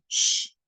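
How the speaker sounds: isolated unvoiced "ch" noise; long voiceless "sh" on its own; single digraph sound. Shown as a demonstration. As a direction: demonstrate one consonant sound, held as a hiss. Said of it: "tienen un solo sonido".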